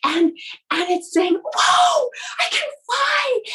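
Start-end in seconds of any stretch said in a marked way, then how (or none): 1.3-3.4 high-pitched thrilled cry of discovery; excited baby bird. Acted. Baby bird soaring on Mom's back for the first time, thrilled. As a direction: whoop it out high, like a first flight. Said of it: "it's getting so excited".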